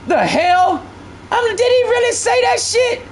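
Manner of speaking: can't believe what you heard; stunned disbelief. Real, not acted. Disbelief at viewer.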